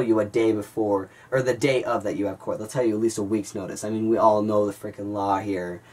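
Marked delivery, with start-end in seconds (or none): none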